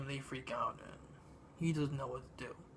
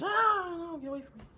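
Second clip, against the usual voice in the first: high shriek then high frightened plea; mock scream; 5 dB louder than usual. The bride shrieking at the monster, mock high scream. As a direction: scream and push him away. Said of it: "she screams".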